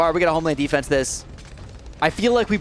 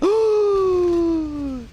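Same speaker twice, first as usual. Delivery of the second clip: sharp gasp; 12 semitones higher than usual. Real, not acted. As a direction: gasp in real shock at the screen. Sudden panic at game glitch.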